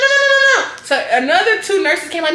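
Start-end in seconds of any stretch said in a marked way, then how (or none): 0.0-0.8 high loud rapid nos; mock alarmed no. Nurse stopping the patient getting up, high loud alarmed nos. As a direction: stop her fast, high and loud.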